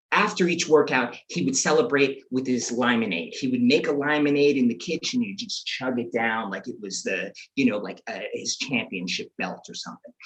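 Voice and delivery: ordinary talk, nothing marked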